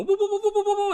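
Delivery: rapid high-pitched whooping; Homer Simpson. Acted. Co-host clowning about storm panic, frantic whooping. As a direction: whoop woo-woo-woo in a fast high, loud run. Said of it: "like Homer Simpson and just go".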